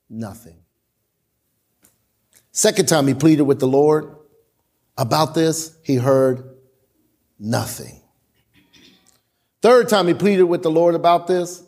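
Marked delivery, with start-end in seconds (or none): 0.1-0.6 nothing dropped low and much softer; dramatic hush. Acted. He heard nothing, dramatic drop. 7.4-8.0 nothing dropped low and softer; dramatic hush. Acted. Repeat of dramatic drop.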